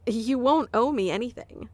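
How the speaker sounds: normal talking voice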